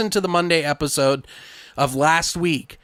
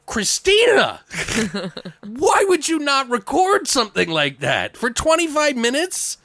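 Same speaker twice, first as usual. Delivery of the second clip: incredulous exasperation; 11 semitones higher than usual. Retold or imitated. Host retells scolding wife for not recording, incredulous. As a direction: plead in disbelief. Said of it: "I'm like".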